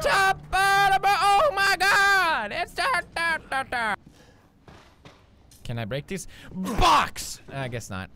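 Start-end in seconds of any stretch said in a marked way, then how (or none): 0.0-4.0 loud, high yell breaking into humming; shouted greeting. Retold or imitated. Showing how it's really done, loud and high. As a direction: bellow the greeting and lose it. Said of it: "that's not even how you do it".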